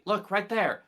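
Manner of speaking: loud, high urgent call. Acted. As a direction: shout to point out the spot. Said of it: "I'm gonna say".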